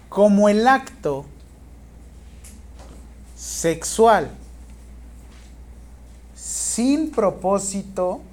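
slow, loud teacher's dictation. Real, not acted. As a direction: dictate in spaced-out chunks, projecting firmly. Teacher dictating exam question to students.

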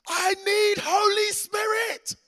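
high, slow, drawn-out wailing yell; hammed-up toddler holler, very loud; imitated child's yelling. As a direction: mimic a distraught little girl shouting. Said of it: "she just keeps yelling it".

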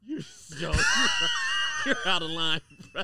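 shouting while laughing; laughing protest. Real, not acted. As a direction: protest through big laughter.

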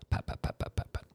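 whispered pat pat of heels fading off; heel clicks. Retold or imitated. Storyteller mimicking the doctor's heels on marble, whispered. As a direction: mimic footsteps walking away. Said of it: "heels on the marble floor".

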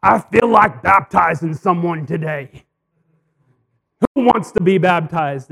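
booming preacher voice calling for volunteers; preacher's call. Retold or imitated. Preacher asking his congregation for a volunteer, loud and booming. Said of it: "the preacher up front said".

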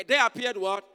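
loud, high rallying question; loud call-out. Real, not acted. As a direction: demand the answer loudly. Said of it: "I want you to say very loud".

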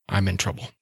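quietly, almost under the breath; worried low confession; hushed confession. Acted. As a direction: confide it so mom won't hear. Ari confides secret after door closes.

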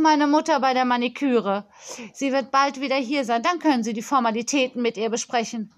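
put-on a pampered child scolding a supplier; spoilt girl on phone. Girl voiced, lists her names.

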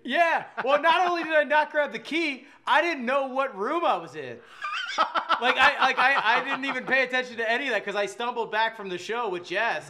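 high and loud, laughing through the words. Real, not acted. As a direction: laugh it out while telling. Laughing retelling of naked mishap.

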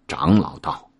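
ordinary delivery, nothing marked

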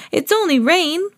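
put-on high-pitched child reassurance; little pig brushing off worry; Peppa. A narrator's reading. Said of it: "said Peppa".

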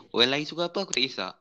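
ordinary talk, nothing marked

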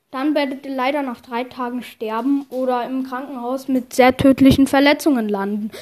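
ordinary talk

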